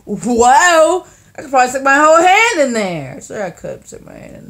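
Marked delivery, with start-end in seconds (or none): 0.1-1.1 amazed whoa. Retold or imitated. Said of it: "I'm like".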